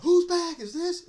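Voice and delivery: high, loud, drawn-out holler; shrill mock shout across the room; imitated lady's yell. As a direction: yell out like an indignant lady. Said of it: "lady yell out".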